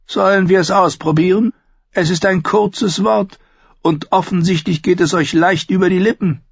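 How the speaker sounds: Tyrion's voice. Acted.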